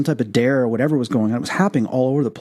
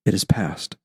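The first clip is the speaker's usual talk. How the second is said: calm inner voice; 3 semitones lower than usual. Retold or imitated. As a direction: let it fall gently like a calming presence. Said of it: "I feel this calmness come over my body and says".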